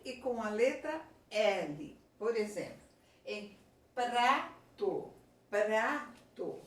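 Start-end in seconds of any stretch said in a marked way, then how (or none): 3.9-6.6 "prato" stretched out, P and R both clearly heard; "prato" drawn out slowly with a distinct PR cluster; both consonants sounded. Shown as a demonstration. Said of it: "Tanto eu falei o P como eu falei o R".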